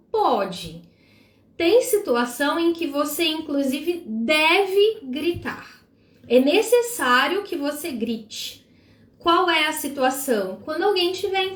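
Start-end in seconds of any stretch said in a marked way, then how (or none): none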